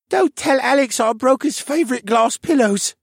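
put-on high, dazed little boy voice talking in his sleep; a sleepwalking boy's high, dreamy murmur; sleepy murmur. A narrator's reading. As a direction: mumble it like a sleepwalking kid. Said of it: "he murmured".

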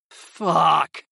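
ordinary delivery

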